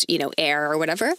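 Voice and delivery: ordinary talk, nothing marked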